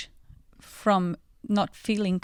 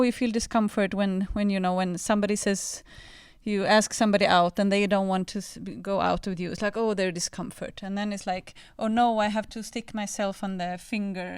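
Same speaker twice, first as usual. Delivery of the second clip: ordinary talk, nothing marked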